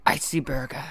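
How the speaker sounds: bad impression, voiceless and rough; [name]. Announcer imitating how someone says a player's name, badly done impression. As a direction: attempt a catchphrase with a voice that isn't up to it. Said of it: "that was a bad impression".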